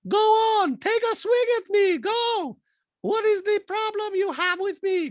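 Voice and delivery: loud, very high confrontational impression; Diana. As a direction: challenge someone in a mock falsetto. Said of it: "Diana goes".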